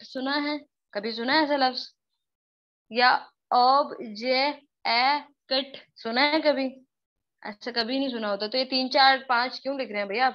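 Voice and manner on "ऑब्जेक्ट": ऑब्जेक्ट chopped into four pieces, ob-je-e-kt; wrongly over-split word. Shown as a demonstration. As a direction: say ऑब्जेक्ट very slowly as ob, je, e, kat. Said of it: "पूरी sound को एक पता नहीं क्या बना डाला है".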